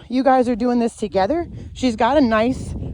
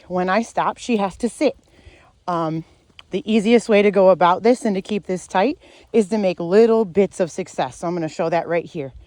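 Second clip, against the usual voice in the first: ordinary talk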